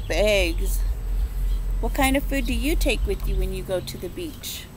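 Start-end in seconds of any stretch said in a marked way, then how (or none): none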